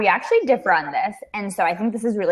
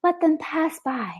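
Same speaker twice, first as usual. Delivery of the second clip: guided meditation voice; 3 semitones higher, slower than usual. Retold or imitated. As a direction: drift it out like a calm app narrator. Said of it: "Have you been practicing your guided meditation voice?".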